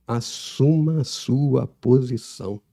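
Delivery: drawn out, every word weighed; slow and deliberate; very slowly. Shown as a demonstration. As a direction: press each word in slowly like a hot needle. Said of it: "Eu vou falar bem devagar pra entrar como uma agulha".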